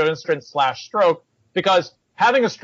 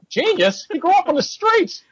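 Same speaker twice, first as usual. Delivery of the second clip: incredulous; 13 semitones higher than usual. Retold or imitated. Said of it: "I'm like".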